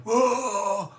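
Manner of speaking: high, loud retching whoa; weird whoa shout. Retold or imitated. Unwell man crying out in the park, loud high whoa. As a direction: let out a sick, wailing whoa. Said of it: "I, like, do this weird song".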